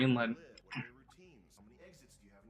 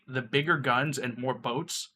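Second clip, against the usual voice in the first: normal talking voice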